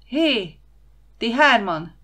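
high, loud call across the water; a loud warning shout. A narrator's reading. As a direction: hail the three children from the jetty. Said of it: "kiáltotta".

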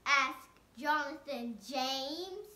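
ordinary delivery, nothing marked

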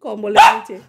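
normal talking voice

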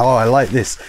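ordinary talk

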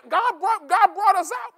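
ordinary talk, nothing marked

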